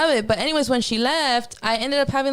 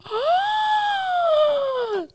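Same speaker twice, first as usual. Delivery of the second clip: shocked gasp. Retold or imitated. Gasping at the hair all over the floor. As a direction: gasp as if seeing the damage.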